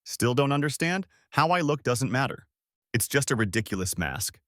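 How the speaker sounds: ordinary delivery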